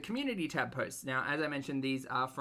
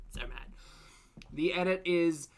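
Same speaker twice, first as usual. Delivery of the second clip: ordinary talk, nothing marked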